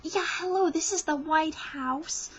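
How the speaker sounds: mock Palin picks up the red phone. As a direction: ham up a chirpy hello. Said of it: "is she gonna answer it with just kind of like".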